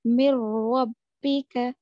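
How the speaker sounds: slow, loud मिर रब्बिका with the r doubled; careful Quranic reading of मिर रब्बिका; Tajweed recitation. Shown as a demonstration. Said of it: "नून को गुन्ना नहीं करना है".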